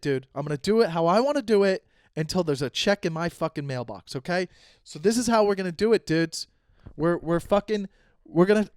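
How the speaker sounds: normal talking voice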